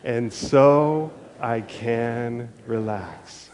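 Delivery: slow call for the room to echo; slow and projected. Shown as a demonstration. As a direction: lead the congregation, slow and carrying. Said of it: "Can you practice that with me?".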